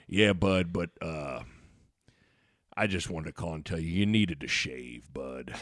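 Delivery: very low, soft deadpan coach; Coach Altman. Retold or imitated. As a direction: imitate a dead-serious coach mumbling. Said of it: "he was dead serious".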